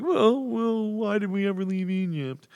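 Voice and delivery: high-pitched mock whine; hammed-up complaining wanderer; whiny Israelites voice. Shown as a demonstration. Preacher demonstrating the voice for Israel's complaints, mock whiny. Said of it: "always use that voice for the people of, of Israel".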